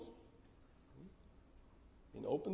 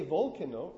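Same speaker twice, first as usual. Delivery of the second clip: volcano as "VOL-kuh-no", front-stressed; wrong stress, first syllable; 4 dB louder than usual. Shown as a demonstration. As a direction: punch VOL, weaken the middle of volcano. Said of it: "don't produce the second syllable as a heavy syllable".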